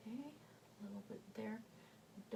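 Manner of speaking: soft unvoiced whisper to himself. Real, not acted. Painter whispering to himself over the canvas, hushed. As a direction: murmur it under your breath while painting.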